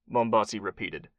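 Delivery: ordinary delivery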